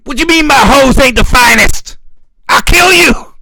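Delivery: high, loud screamed threat; mock furious threat. Mocking an American rapper threatening over his hoes, loud high yell.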